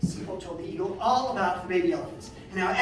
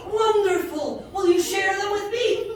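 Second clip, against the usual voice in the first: eager Sifa; 10 semitones higher, 5 dB louder than usual. A narrator's reading. Sifa eager to hear stories.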